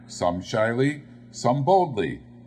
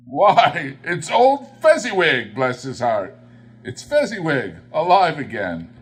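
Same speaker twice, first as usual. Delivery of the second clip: higher and louder, bursting with glad recognition; great excitement; 5 semitones higher, 7 dB louder than usual. A narrator's reading. Scrooge seeing his old master again, excited cry. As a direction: cry out as if a lost friend walked in. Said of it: "Scrooge cried in great excitement".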